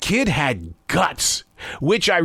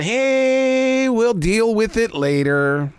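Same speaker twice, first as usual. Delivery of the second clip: drawn-out, breezy "hey", then slow; casual put-off; 5 semitones higher, much slower than usual. Acted. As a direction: brush it aside, stretching the words. Voices people putting things off.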